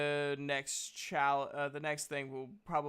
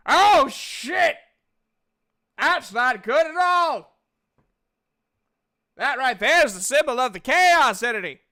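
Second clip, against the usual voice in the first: loud, high, hammed-up exclamations; alarmed game character; 10 semitones higher, 15 dB louder than usual. Game master's character spots the chaos symbol, loudly alarmed. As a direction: shout it in big swooping character voice.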